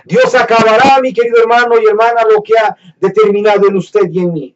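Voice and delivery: loud, high, fast exhortation; preaching. Acted. Preacher assuring brothers and sisters, loud and fast. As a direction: proclaim it over the crowd.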